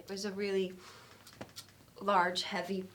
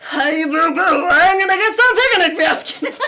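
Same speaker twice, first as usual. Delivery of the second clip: much higher, much louder cartoon bear voice; funny voice; 8 semitones higher, 19 dB louder than usual. Woman showing off her funny voices to her roommate, loud cartoon bear. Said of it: "I have a number of funny voices".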